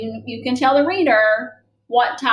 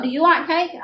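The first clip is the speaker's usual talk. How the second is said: asking question; 5 semitones higher than usual. Shown as a demonstration. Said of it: "'Cause I wanna know".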